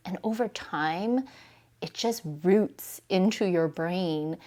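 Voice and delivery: ordinary delivery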